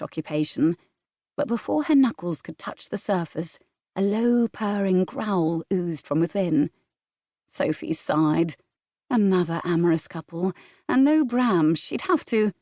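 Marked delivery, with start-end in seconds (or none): none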